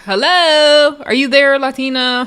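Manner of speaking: teasing, high "anybody home" call; mock calling out. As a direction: call out as if nobody is answering. Mock-checks on a silent cast member.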